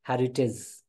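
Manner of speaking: "हेरिटेज" ending in short IZ; correct pronunciation. Shown as a demonstration. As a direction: say "हेरिटेज" as HERI-tiz. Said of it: "heritage of India नहीं होता है". ("हेरिटेज" को हेरिटिज़ बोलें)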